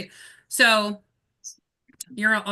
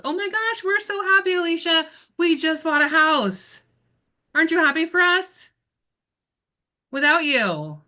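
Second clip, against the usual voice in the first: loud, high-pitched mock delight; gushing clients; 9 semitones higher, 5 dB louder than usual. Clients breaking house news to their bypassed agent, gushing with excitement.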